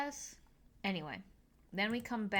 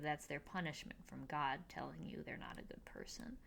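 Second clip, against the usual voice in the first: whisper; 3 semitones lower, 8 dB quieter than usual. Real, not acted. Sarcastic aside about ugly people.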